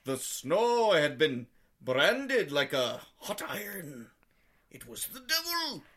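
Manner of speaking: put-on high, slow, eerie old-timer voice that shoots up at the end; spooky witness voice. Cohost acting a frightened witness, in an eerie high voice. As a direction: play the terrified villager swearing it was the devil.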